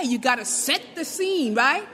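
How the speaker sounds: normal talking voice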